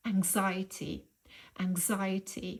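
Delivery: anxiety said as ang-ZY-uh-tee, stress on the second syllable; pronunciation model. Shown as a demonstration. Teacher giving the noun anxiety, said slowly and clearly. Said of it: "والاسم منه".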